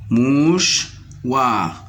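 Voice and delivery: "Mouchoir" split as mouch-oir with a pause; syllable by syllable. Shown as a demonstration. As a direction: lift the first half of "Mouchoir", then drop on the second. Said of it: "dans la deuxième syllabe". (« Mouchoir » découpé : mouch… oir)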